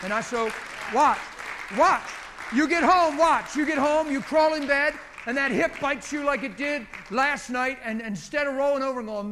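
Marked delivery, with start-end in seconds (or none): 0.9-7.8 loud, high, forceful exhortation; urgent preaching. Real, not acted. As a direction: drive it home to the congregation at full voice. Preacher urgently presses the point home.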